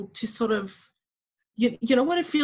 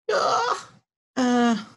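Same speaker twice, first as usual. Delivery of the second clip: crying. Real, not acted. Emotional moment, voice breaking.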